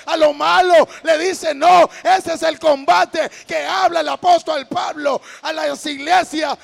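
shouted sermon, punching each item of the list; full-force preaching. Real, not acted. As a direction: preach it very loud, phrase by phrase. (prédica a gritos, con toda la fuerza)

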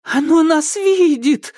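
high, loud cry of terror; panic, drawing the words out; horror-struck character squeal; horrified. A narrator's reading. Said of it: "зашлась в ужасе Кикимора".

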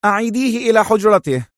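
a high, curt command to the maid; harsh order. Acted. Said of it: "دفعته في غلظة".

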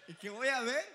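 loud, laughing through the near-drowning story; nervous, loud laughter telling the scare. Real, not acted. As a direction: laugh loudly as the tension builds. Laughing retelling of drowning child.

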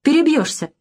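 high, cutting refusal; curt rebuff. A narrator's reading. Girl refuses the drunk host's kiss, curt rebuff. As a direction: cut him off in one word. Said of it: "отрезала Таня".

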